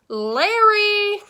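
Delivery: high, loud, long-held name; sudden call. A narrator's reading. Star recognizes Mister Sims, calling out suddenly. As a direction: break off and sing out the name. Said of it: "she called out suddenly".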